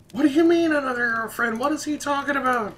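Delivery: hammed-up high-pitched, fast girlfriend voice; put-on falsetto girlfriend; mock girlfriend. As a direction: squeal it like an outraged girlfriend, very high. Imitating an angry girlfriend.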